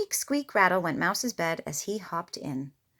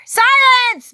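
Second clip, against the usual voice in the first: much higher, much louder roared command; 17 dB louder than usual. A narrator's reading. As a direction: roar it in fury. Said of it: "Bear roared".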